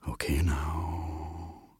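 very slow, hushed Death; gentle, breath-soft and lingering; soft caution. A narrator's reading. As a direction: draw it out softly, almost whispered. Death checks before driving off.

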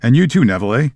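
ordinary talk, nothing marked

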